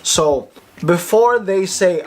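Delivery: ordinary delivery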